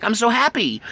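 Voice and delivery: happy dog. Retold or imitated.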